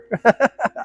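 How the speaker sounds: sudden high burst of laughter; a real self-mocking laugh; short laugh. Real, not acted. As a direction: laugh at your own confession. Laughs at himself.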